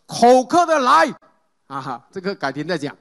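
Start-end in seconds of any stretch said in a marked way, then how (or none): 0.1-1.1 slow, raised higher call; Jesus calling out. Retold or imitated. Preacher voicing Jesus inviting the thirsty, slow raised call. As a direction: lift it like a cry to the crowd. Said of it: "耶稣大声喊着".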